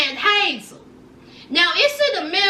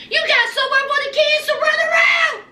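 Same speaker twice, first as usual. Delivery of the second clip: excited urging; 9 semitones higher than usual. Real, not acted. Telling Brandon to take the land, excited and high. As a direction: sell the idea hard, going up high.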